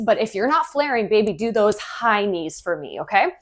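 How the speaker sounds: ordinary talk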